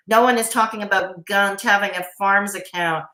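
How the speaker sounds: normal talking voice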